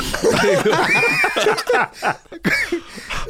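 a short, high burst of laughter. Real, not acted. Friends laughing about the story, high laugh. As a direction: laugh high, in real amusement.

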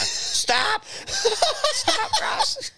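hammed-up mum yelling through laughter; mock scream. Mum yelling from the veranda at the fighting boys, laughing mock scream. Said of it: "Mum's screaming over the veranda going".

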